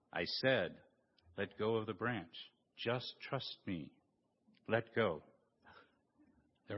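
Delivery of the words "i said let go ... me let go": low, soft divine voice calling down; voice of God. Acted. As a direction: speak calmly from above, much lower. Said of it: "I'm God".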